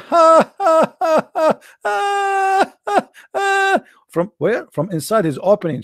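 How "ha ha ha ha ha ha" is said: high shouted mock cackle; devil laugh.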